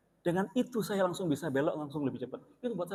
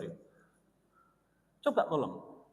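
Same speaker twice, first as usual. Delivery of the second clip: ordinary talk, nothing marked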